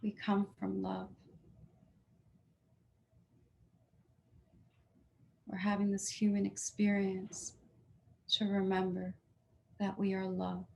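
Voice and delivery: soft, hushed meditation voice; hushed healing guidance to the heart; guided meditation. Acted. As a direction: gently guide them inward, softly.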